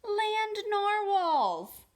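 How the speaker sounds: high, slow and loud amazement; excited cry. Acted. Kelp shouting with wonder when he sees the unicorns. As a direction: stretch it out with wonder.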